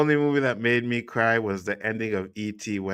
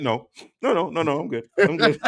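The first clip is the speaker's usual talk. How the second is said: mock denial; 6 semitones higher than usual. Acts out denying he cried.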